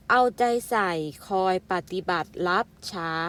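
usual speaking voice

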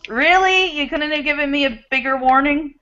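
high, incredulous Really, Really; mock-outraged at the men; exasperated complaint. To the men in her life, incredulous and mock-outraged. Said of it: "to the men in my life and go".

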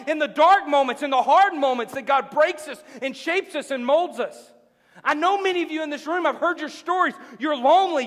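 high, driving, impassioned sermon; full-force preaching. Real, not acted. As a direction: pile up the phrases and drive each one home.

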